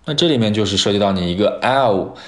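ordinary talk, nothing marked

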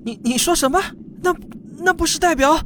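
the floor drops away; shocked outburst. Acted. Father hearing the ship never left Earth, shocked high outburst.

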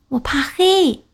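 high, small scared cub voice; a timid little cub afraid of the dark; little bear voice. Acted.

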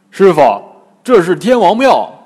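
big loud announcement; Pigsy calling out. A narrator's reading. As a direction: holler the news to the master. Bajie calls out to master.

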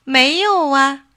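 high, drawn-out innocent denial; a naive little rabbit answering truthfully; honest rabbit. A narrator's reading. Rabbit tells the fox the plain truth, innocently. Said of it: "兔子照实说".

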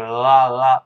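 held l sound, written "呃", tongue lingering; thick-tongued L. Shown as a demonstration. Teacher repeats L for students, loud thick-tongued sound. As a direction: press and hold the tongue for "呃". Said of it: "好像大舌头那种".

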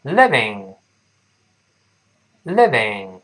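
very slow "living", short I and clear V; "living" modelled with teeth-on-lip V; repeat-after-me model. Shown as a demonstration. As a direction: say "living" twice slowly with pauses for learners. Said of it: "再来一遍".

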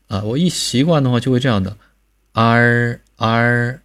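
啊 said with the tongue curled back like erhua; wrong erhua. Shown as a demonstration. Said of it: "为什么错了呢？你不就是读的是儿化音吗". (错误的儿化音读法)

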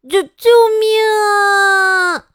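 high, loud stammering scream for help; terrified child shouting for help; cry for help. Acted. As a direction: scream as the giant hand grabs you. Child grabbed by the monster.

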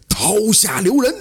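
high, urgent call to stop; shouted halt. A narrator's reading. Stranger at the execution ground calling out. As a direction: cry out to stop the beheading. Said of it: "有人高喊一声".

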